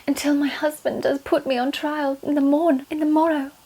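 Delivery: mock olde English lament; Anne Boleyn. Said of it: "How did they speak?".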